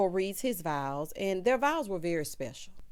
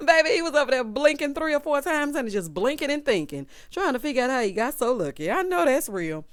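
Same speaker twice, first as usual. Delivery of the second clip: amused; 5 semitones higher, 7 dB louder than usual. Real, not acted. Laughing over the groom's reaction.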